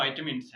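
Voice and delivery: "विटामिन्स" said as VAI-tuh-mins; English-style "विटामिन्स", vai-tuh-mins; correct pronunciation. Shown as a demonstration. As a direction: start "विटामिन्स" with "vai", short middle vowel. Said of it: "जो इसकी सही pronunciation है".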